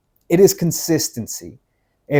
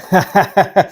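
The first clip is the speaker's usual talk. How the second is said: short amused burst of laughter, a bit higher and louder; real laugh; 4 semitones higher, 4 dB louder than usual. Real, not acted. Speaker laughs at his snoring dog, amused laugh.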